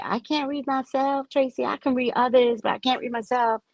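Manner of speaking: high, whiny imitation of a client; quoting people who say they can't read themselves; mimicking clients. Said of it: "a lot of people say".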